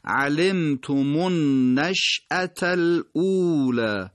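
علمتم النشأة الاولی slow, the three words joined smoothly; slow connected recitation; linked recitation. Shown as a demonstration. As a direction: recite the three words as one flowing phrase. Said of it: "باید به هم دیگه وصل بشن".

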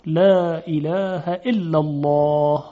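slow لا اله الا الله, light until a dark, full الله; slow recitation of لا اله الا الله with only الله heavy; thin lams, heavy Allah. Shown as a demonstration. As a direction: draw out thin "læː ilæːhæ", thicken the final "llɔːh".

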